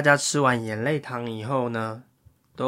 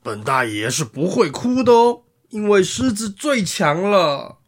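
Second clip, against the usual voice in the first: boastful lion; 4 semitones higher, 4 dB louder than usual. Acted. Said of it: "接下来是狮子".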